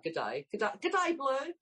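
hammed-up Aussie "good day", rising on "Blue"; fake Australian accent. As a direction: put on a broad mock Australian accent. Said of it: "is that an authentic Australian accent".

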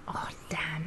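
unvoiced soft whisper. Real, not acted. Whispered reaction.

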